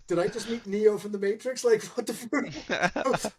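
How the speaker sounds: bewildered squeaky disbelief. Retold or imitated. Teller voices a pro player's stunned thoughts, high and incredulous. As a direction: squeak it like your mind is blown. Voicing Rain's baffled thoughts.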